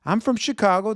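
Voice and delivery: ordinary delivery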